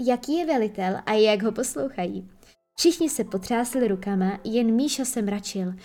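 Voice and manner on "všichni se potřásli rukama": plain reading voice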